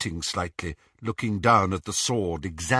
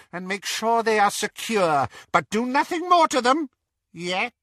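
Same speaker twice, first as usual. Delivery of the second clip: witch threat; 11 semitones higher than usual. A narrator's reading. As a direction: threaten with cold glee. Bellatrix threatens.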